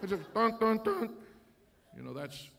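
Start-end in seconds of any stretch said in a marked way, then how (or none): 0.1-1.2 a long loud honk-like blast; mean honk. Retold or imitated. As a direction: imitate an angry car horn. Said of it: "I didn't do it mean".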